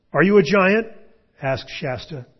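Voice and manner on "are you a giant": high, loud, anxious asking; scared boy's high-pitched question; frightened question. A narrator's reading. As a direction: blurt the question in alarm. Frightened boy questions unseen thing.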